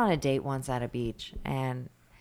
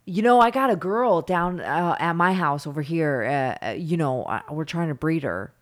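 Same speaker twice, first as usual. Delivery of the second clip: lightly voiced pushy stranger, starting loud; imitated dog breeder; 2 semitones higher, 7 dB louder than usual. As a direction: pitch a shady offer to a dog walker. Imitates pit bull breeder stranger.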